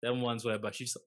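ordinary talk

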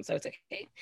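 breathy whispered aside; hushed sarcastic whisper. Retold or imitated. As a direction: drop to a whisper on "so it's okay". Whispered aside, then repeated aloud.

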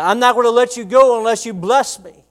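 high, loud insistent demand; determined cry. Retold or imitated. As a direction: refuse to let go. Jacob's demand to the angel.

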